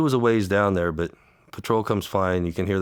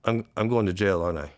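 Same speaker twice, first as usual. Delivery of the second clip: high voice, halting as emotion takes over; real lump in the throat, breaking off; choked up; 5 semitones higher than usual. Real, not acted. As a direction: struggle to get the words out without crying. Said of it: "I don't know why I get like this".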